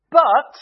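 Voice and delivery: a big, loud But; heavy stressed But; emphatic but. Real, not acted. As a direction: punch the But hard. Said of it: "this is the big but".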